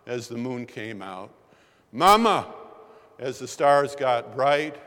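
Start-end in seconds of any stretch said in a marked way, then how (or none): 1.9-2.5 loud high echoing call for mama; a remembered cry drifting over the lake; child's distant cry. Retold or imitated. Preacher recalls a boy calling across the lake, loud and high. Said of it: "you can hear that voice".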